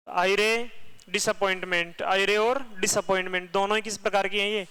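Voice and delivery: ordinary delivery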